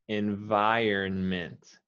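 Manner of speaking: "environment" drawn out slowly, every sound clear; slow model word. Shown as a demonstration. Teacher modelling the word slowly for her student. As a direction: stretch "environment" and say each syllable. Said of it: "you do wanna say the N".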